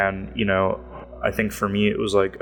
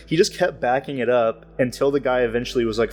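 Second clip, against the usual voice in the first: ordinary talk, nothing marked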